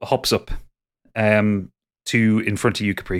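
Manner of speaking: ordinary talk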